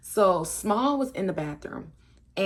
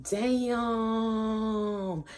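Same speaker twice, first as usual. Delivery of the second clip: "Damn" stretched out very long; long, held, impressed "Damn"; stunned reaction; 3 semitones higher than usual. Retold or imitated. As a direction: draw out "Damn" in retold amazement. Own stunned reaction, retold.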